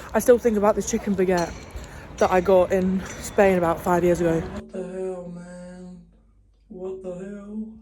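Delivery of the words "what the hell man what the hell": much softer, slow muttered what the hell; muttered disbelief. Real, not acted. Staring at an ugly town, soft muttered disbelief. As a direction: drop it down low in disbelief.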